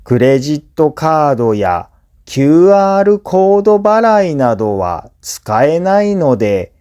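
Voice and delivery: ordinary talk, nothing marked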